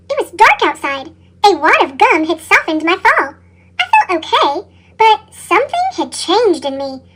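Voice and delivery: usual speaking voice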